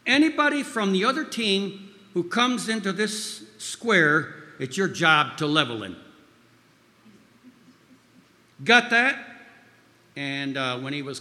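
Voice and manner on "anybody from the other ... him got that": loud, high, forceful instruction; imitated yell. Mentor giving player his job on the line, imitated yell. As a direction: imitate a tough mentor driving the order home. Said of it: "yelled in his distinctive accent".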